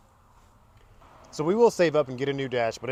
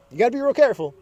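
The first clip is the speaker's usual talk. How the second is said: usual speaking voice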